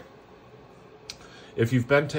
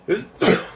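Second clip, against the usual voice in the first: sneezing; 11 semitones higher, 4 dB louder than usual. Real, not acted. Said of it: "so sorry for the sneeze".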